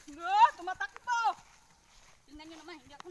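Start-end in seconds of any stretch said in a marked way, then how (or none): 0.1-1.2 loud, high excited call; excited shout. Real, not acted. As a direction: shout with thrill as it runs. Horse starts running.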